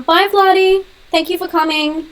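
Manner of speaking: high, lilting goodbye to a guest; high-pitched farewell. Real, not acted. Calling goodbye to departing viewer.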